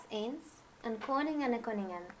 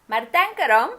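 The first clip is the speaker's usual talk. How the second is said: much louder warning call; calling after; 3 semitones higher, 12 dB louder than usual. Acted. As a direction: shout it after her as she climbs down. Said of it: "riep de heks er achterna".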